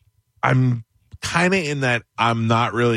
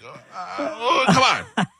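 high, slow, whining groan; pained disbelief; 11 semitones higher, much slower than usual. Retold or imitated. Narrator mimicking his groan at the dad. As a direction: moan it out in exasperation. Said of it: "and I was like".

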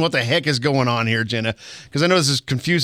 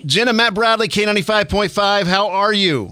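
big booming radio announcer voice; full DJ broadcast delivery; 4 semitones higher than usual. Real, not acted. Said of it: "I've got that big announcer voice going".

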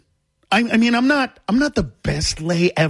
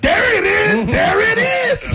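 triumphant shout. Retold or imitated. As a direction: shout it like you struck gold. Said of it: "I literally went".

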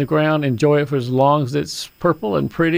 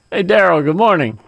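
ordinary talk

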